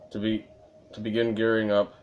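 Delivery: usual speaking voice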